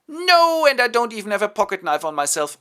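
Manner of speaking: high, loud outburst; father learning of the arrangement; outraged father. Acted. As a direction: explode in fury. Father enraged at news.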